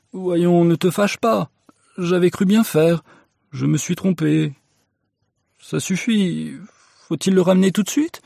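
high-pitched, apologetic pleading; submissive, high-voiced excuses; placating husband. A narrator's reading. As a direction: give in and soothe your angry wife. Husband placates his angry wife.

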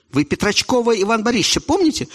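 usual speaking voice

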